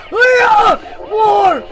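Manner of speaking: loud high shout of war; battle cry. Acted. A worker cries out as fighting starts, loud.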